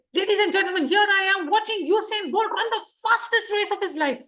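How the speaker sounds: energetic announcer hype; excited commentator. Shown as a demonstration. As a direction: call the race like a thrilled sports commentator. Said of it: "More appropriate?".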